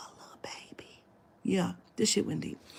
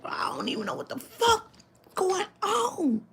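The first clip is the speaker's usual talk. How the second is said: much higher, half laughing, half crying; distressed high voice; 12 semitones higher than usual. Retold or imitated. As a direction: cry it out, bewildered. Friend distraught.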